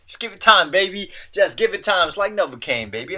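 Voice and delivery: high, loud playful coaxing; loud joking self-encouragement. Real, not acted. Cheering himself on through a hard word, loud and playful.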